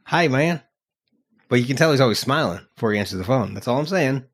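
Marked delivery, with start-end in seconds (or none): 0.1-0.6 higher, upbeat hey with a rise. Retold or imitated. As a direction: greet like you're grinning. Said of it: "like [name] answered the phone".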